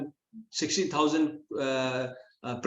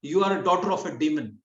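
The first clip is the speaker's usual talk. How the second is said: higher-pitched acted comeback; indignant retort; 4 semitones higher, 3 dB louder, faster than usual. Satyabhama answering Mother Sachi's insult, indignant retort. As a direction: throw the insult back, offended. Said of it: "Satyabhama got back to her".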